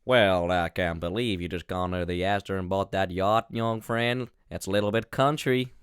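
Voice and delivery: slow West Virginia country drawl; West Virginia accent. Shown as a demonstration. Podcaster repeats co-host's line in a slow West Virginia drawl. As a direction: put on a folksy West Virginia twang, slowly. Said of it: "I'll say it in West Virginia".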